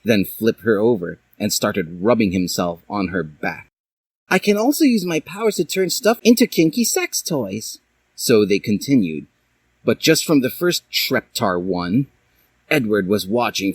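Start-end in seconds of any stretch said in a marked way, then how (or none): 4.3-7.8 put-on much higher girl voice; Dally. A narrator's reading. Dally talking to Hiei, much higher voice. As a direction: offer it playfully.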